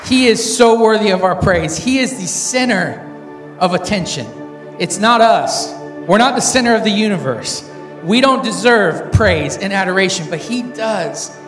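loud hype-man worship call; rally speech. Acted. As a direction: fire up the crowd, full voice. Hyping crowd before sermon.

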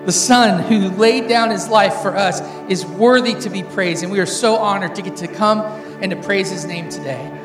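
loud hype-man worship call; rally speech. Acted. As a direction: fire up the crowd, full voice. Hyping crowd before sermon.

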